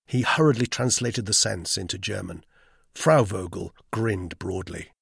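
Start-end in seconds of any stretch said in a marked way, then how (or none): none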